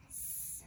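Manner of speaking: s hissed with no voice, just air; whispered s sound. Shown as a demonstration. Teacher sounding out the letter s for kids. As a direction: breathe out a soft s sound. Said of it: "sounding out the letter".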